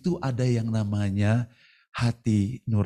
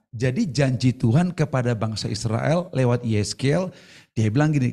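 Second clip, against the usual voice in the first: ordinary delivery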